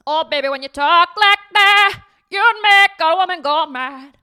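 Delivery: high, loud sung-out [name] imitation; [name] impression. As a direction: belt it like [name], playful. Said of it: "let me do my [name] impression".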